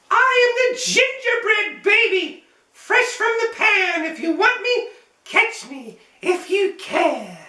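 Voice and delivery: high, gleeful little cookie voice, slowing at the end; sing-song taunt of a runaway cookie; gingerbread baby voice. Acted. Cookie taunting Matty's family, high gleeful chant.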